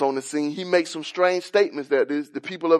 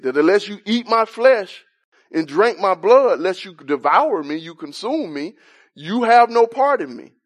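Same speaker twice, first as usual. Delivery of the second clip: rising sermon fervor; 4 semitones higher than usual. Acted. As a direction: build the sermon toward the climax. Preaching builds higher.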